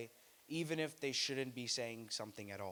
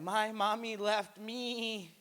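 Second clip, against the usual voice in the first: put-on high, slow whimpering little kid; a child sniffling to a stranger; crying kid; 9 semitones higher, 4 dB louder, much slower than usual. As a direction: whine it like a sad toddler. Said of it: "And they're like".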